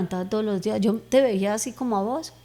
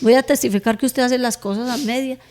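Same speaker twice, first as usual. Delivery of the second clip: retold protest to God, louder and higher; challenging prayer; 4 semitones higher, 7 dB louder than usual. Woman challenging God in her retold prayer, loud insistent protest. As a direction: press the challenge on God, voice raised. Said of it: "Yo le decía en la oración".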